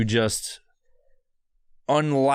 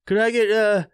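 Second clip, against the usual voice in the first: mock customer voice; 11 semitones higher than usual. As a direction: ham up a pushy customer ordering. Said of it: "he's like".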